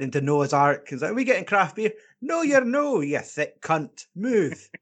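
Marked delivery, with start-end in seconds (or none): 1.1-1.9 higher-pitched eager little question; lightly voiced hopeful prize hopeful; hopeful contestant. Acting contestants coming for their prize. 2.2-4.7 high-pitched dismissive rebuke, slowing on the insult; play-scold a hopeful contestant; mock berating. Acting host berating hopeful contestants.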